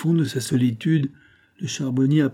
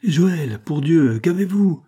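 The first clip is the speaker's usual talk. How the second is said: higher, louder alarmed plea; frightened plea; 6 semitones higher, 5 dB louder, slower than usual. A narrator's reading. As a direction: beg him to stop in fright. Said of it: "demanda Bruland effrayé".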